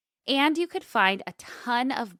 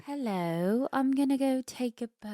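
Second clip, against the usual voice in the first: put-on a light posh English accent, a bit fast; posh British accent; 4 semitones higher than usual. Cohost teases about the Thames, posh British accent. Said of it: "Oh, posh".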